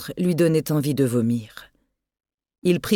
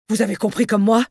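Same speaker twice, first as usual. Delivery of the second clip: stunned yell; 4 semitones higher, faster than usual. Acted. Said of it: "gueula Vallon d'une voix ahurie".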